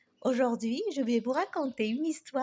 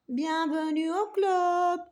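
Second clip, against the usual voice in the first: proud but tired; 4 semitones higher, 5 dB louder, slower than usual. Acted. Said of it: "D'un ton fier mais fatigué".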